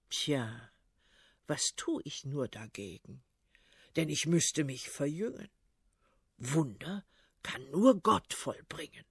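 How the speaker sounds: put-on deep, quiet, perplexed old tsar; puzzled old tsar. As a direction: scratch your head and mumble low.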